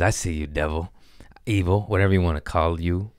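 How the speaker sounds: usual speaking voice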